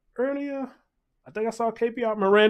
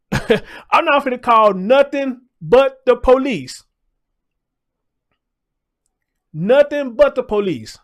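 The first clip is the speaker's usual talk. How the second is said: laughing emphatic; 3 semitones higher, 5 dB louder, slower than usual. Real, not acted.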